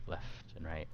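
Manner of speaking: ordinary delivery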